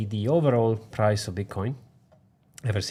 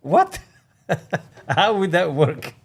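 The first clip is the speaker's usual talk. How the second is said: higher, laughing disbelief; amused, pitched-up disbelief; incredulous retort; 5 semitones higher, 4 dB louder than usual. Retold or imitated. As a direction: retell your baffled comeback to a silly accusation. Acting out incredulous reply to commenter.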